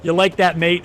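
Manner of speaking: loud, high, hammed-up Midwest American take on the catchphrase; co-caster mocking his own Midwest American accent; bad Midwest American accent. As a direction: butcher the line in a Midwest American accent on purpose. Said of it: "as I butcher it with my horrible, ugly, Midwest, nasally American accent".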